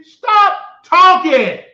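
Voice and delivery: slow, loud, high command; stop talking. Real, not acted. Telling Rico off on stream, slow loud command. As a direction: stretch each word out, loud and cutting.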